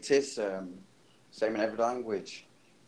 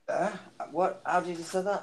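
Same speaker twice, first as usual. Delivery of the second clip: higher-pitched mock bafflement; put-on puzzled questioning, pitched up; imitated puzzled listeners; 8 semitones higher than usual. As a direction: imitate someone who didn't catch it. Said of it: "some people are like".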